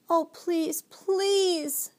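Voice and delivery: high, pleading, sobbing appeal; imploring child's plea; begging. A narrator's reading. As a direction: beg desperately, stretching the second please. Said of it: "she begged".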